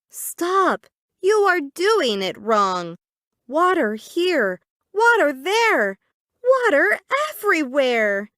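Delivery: a sister scolding her brother for making a mess, high bossy voice. Acted. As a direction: tell him off like a big sister. Zoe scolds Zach again.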